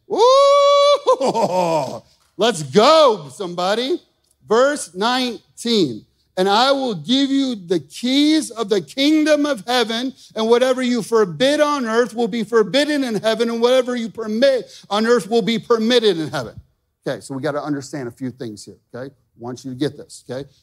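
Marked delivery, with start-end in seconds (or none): 0.1-4.0 loud whoop then a rallying shout; preacher cheering on his church; hyped cheer. Real, not acted. Preacher hypes the congregation. 4.5-16.6 loud, high proclaiming of the verse; preacher booming out scripture; forceful scripture reading. Acted. Reads scripture at full force.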